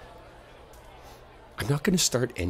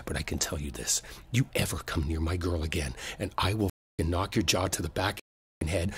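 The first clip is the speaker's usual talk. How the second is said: softer, lower menace; low menacing threat; 6 semitones lower, 7 dB quieter than usual. Acted. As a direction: threaten him close and quiet. Quiet threat to the harasser.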